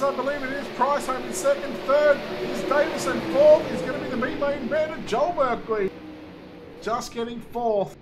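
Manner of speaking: energetic motorsport race call; live race play-by-play. Real, not acted. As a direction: call the race live, surging at passes and contact.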